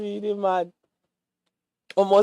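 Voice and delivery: ordinary delivery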